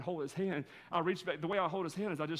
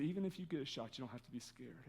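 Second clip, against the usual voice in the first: tender reassurance; 5 semitones lower, 9 dB quieter than usual. Retold or imitated. Father comforting scared son in car.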